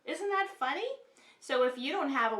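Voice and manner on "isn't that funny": playful. Acted. Teacher joking about sock.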